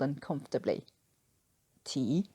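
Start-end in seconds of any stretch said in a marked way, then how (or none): 1.8-2.3 low, soft sheepish mumble; mumbled. A narrator's reading. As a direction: mumble it, embarrassed. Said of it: "he mumbled eventually".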